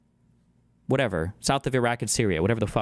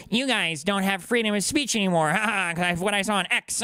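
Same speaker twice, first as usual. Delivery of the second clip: higher mocking voice with laughs; mocking Vance; 6 semitones higher than usual. Mocking Vance lecturing Europe on free speech, sneering. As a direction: lampoon a smug politician lecturing Europe.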